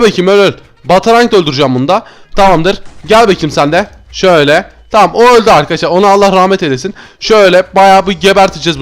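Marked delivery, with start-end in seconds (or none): none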